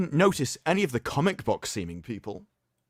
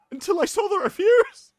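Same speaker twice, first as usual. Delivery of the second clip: hammed-up high sob, louder; mock sobbing; 4 dB louder than usual. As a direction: fake a weepy breakdown over the reviews. Mock sobbing over the reviews.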